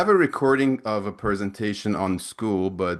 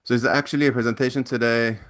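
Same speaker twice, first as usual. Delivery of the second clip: ordinary delivery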